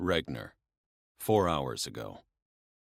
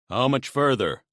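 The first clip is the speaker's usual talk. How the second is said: higher, louder calling out; gruff impatient voice; 8 semitones higher, 8 dB louder than usual. Acted. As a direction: call it over the engine. Impatient driver calls out.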